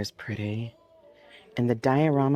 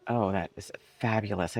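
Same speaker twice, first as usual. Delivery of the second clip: ordinary delivery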